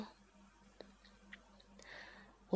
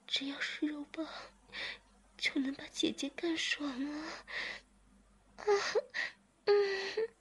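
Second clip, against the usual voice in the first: high moaning, words between the gasps; narrated woman moaning to climax; 9 semitones higher than usual. A narrator's reading. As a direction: moan the lines out at a high pitch. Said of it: "志明呻吟着说".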